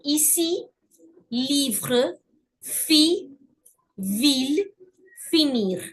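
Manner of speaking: ici, livre, fille, ville, finir with clear "ee"; careful model words one by one, "ee" for every i; French word list with an unchanging "ee" vowel; flat French E. Shown as a demonstration. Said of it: "a flat E".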